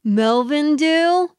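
put-on a clueless beach teen answering; dumb teen. Teen answering a phone call, dumb and slow. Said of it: "teenage guy stands up and he's like".

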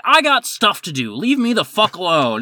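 heated, high-pitched retort; mock yell back. Busy manager yelling back at customer, high mock yell. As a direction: imitate yelling back at a pest. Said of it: "we're both just yelling at each other".

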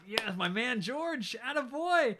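high, drawn-out hurrah for a hero; excited cheering. Acted. Viewer cheering George on.

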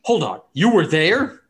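high, loud startled question. Acted. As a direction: caught off guard, voice jumping up. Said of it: "a look of, like, fear".

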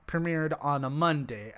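normal talking voice

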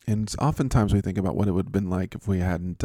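ordinary talk, nothing marked

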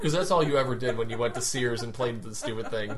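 ordinary talk

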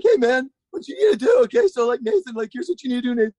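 high, voice-cracking techy mentor; nerdy wizard coaching over the phone; Rodney Mullen as cracking Yoda. Retold or imitated. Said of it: "kind of like a voice cracking version of Yoda".

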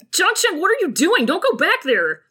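mildly frantic, trying to hold someone back; alarmed pleading. Acted. Wei Wuxian stopping Jiang Cheng from going back, alarmed pleading. Pleading with brother in acted quarrel.

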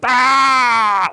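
high shrieking pterodactyl cry; pterodactyl screech. Retold or imitated. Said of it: "like a pterodactyl".

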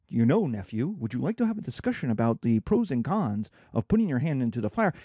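low-pitched, over-reasonable uncle; philosophizing uncle. Acted. Uncle reasoning with nephew at the fire, low mock-philosophical voice. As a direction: drop low and pose it like a philosopher. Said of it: "and I say".

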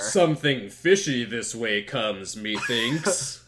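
slow, loud Shakespearean ham; overacted ominous line; hammy stage actor. Voicing King Ham.

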